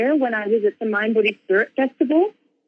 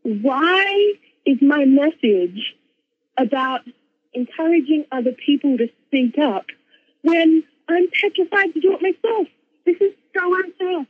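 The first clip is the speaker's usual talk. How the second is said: higher-pitched, protesting complaint; retold anger; 7 semitones higher than usual. Her angry part protesting her message, retold anger. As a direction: act out an indignant rant at unfairness. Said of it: "really angry".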